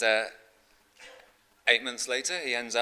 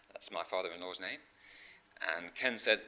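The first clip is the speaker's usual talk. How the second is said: ordinary talk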